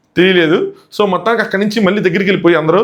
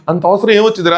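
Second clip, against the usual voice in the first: ordinary delivery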